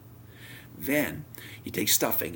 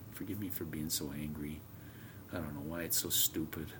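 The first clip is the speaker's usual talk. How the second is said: soft, low, retold apology; subdued apology; 5 semitones lower, 9 dB quieter than usual. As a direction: quietly ask forgiveness, acting out the moment. Retold apology to his wife.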